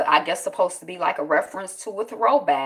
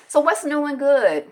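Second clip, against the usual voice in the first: usual speaking voice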